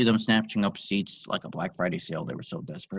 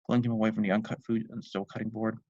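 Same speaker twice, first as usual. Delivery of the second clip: ordinary delivery, nothing marked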